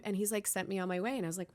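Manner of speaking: ordinary talk, nothing marked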